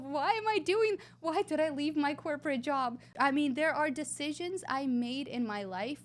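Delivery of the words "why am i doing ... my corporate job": anguished self-questioning. Retold or imitated. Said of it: "And I ask myself".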